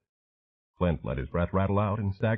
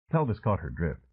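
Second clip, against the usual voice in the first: usual speaking voice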